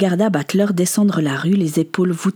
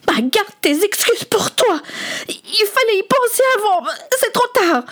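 very high, bitter retort; angry rejection. Acted. Son rejects his father's excuses, angry. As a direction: throw the apology back, high and worked up.

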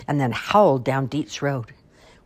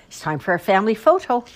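bright, loud call from higher up; 8 semitones higher, 7 dB louder than usual. A narrator's reading. Aunt calling the family in for a photo, loud and high. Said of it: "called out".

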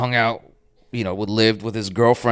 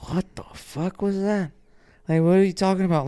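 stunned disbelief; 11 semitones higher than usual. Retold or imitated. Walking away from the teacher's remark, high incredulous disbelief. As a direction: replay the shock of the insult. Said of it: "I walked away from that like".

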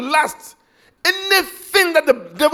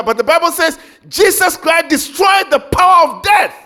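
loud, high proclamation; booming sermon climax; preaching shout; 6 semitones higher, 7 dB louder than usual. Acted. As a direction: shout it to the whole church. Preacher proclaiming at full force.

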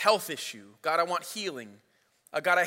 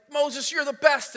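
mock crowd cheer; 7 semitones higher, 4 dB louder than usual. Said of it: "They're like".

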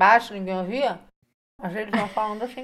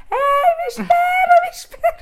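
high, drawn-out yell after a leaving bus; desperate shout for the bus to wait; retold yell; 8 dB louder, slower than usual. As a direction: call out to the bus, much higher and louder, slowed right down. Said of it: "eu comecei a gritar".

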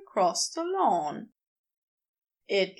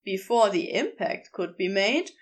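plain reading voice

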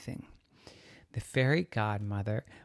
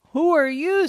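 high, loud startled question; high-pitched alarmed little girl; 11 dB louder than usual. Acted. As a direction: blurt it out as an astonished girl. Girl startled by sudden fairy cow.

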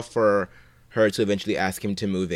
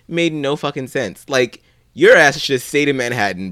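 ordinary talk, nothing marked

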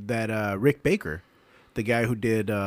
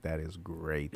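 hushed awe; 5 semitones lower, 10 dB quieter, much slower than usual. Retold or imitated. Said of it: "I'm like".

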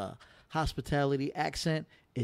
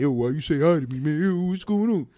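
loud, high, street-tough "yo" voice; mock-hostile New York guy; tough New Yorker; 5 semitones higher, 7 dB louder than usual. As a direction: play a defensive New Yorker snapping back. New Yorker reacting to a greeting.

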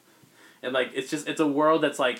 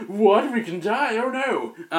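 higher, louder hammed-up alarm; mock panic; 8 semitones higher, 6 dB louder, slower than usual. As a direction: play cowardly villains suddenly mortal. Inheritors freaked out.

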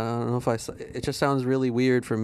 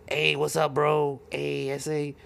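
mock Mexican accent; 4 semitones higher, slower than usual. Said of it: "kids always say".

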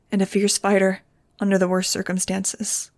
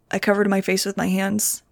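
plain reading voice

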